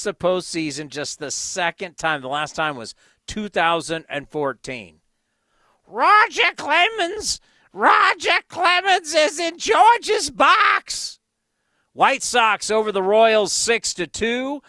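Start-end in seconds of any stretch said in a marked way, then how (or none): 5.9-11.2 high, loud, stretched-out announcement; excited shout. Real, not acted. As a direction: call it out thrilled. Spotting a star in the crowd.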